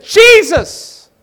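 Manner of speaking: crying out high and loud; shouted plea for rescue; desperate cry. Retold or imitated. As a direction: scream the name in terror. Terrified cry in a vision.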